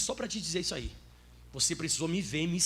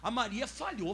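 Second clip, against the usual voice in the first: usual speaking voice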